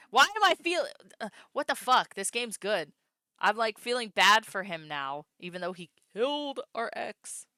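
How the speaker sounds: loud, high, caught off guard; excited outburst. Real, not acted. Streamer reacting to the sad scene, loud outburst. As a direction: blurt it out, flustered.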